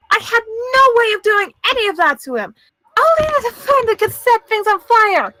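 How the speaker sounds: high, insistent, indignant denial; panicked protest. Acted. Accused witness denying the killing to the court, high panicked protest.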